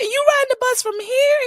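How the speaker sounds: very high, loud incredulous question; imitated disbelief. Stunned passengers questioning dad, mock incredulous. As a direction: squeal it like an astonished stranger. Passengers amazed at the long ride.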